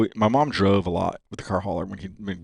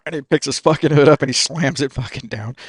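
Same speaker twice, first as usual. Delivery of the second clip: ordinary talk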